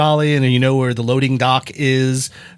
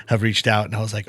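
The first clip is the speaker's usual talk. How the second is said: ordinary talk, nothing marked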